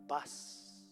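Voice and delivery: whispered, drawn-out word of peace; hushed greeting. Acted. Lord appearing to frustrated disciples, hushed. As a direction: breathe it out as calming reassurance.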